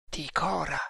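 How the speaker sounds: soft murmur to oneself. A narrator's reading. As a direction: murmur the name, barely voiced. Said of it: "mormorò Tremal Naik".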